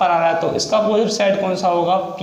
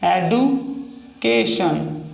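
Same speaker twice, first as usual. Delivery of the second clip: extremely slowed dictation; very slow stretched word; much slower than usual. Shown as a demonstration. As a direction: pull the word out syllable by syllable. Repeats word while writing.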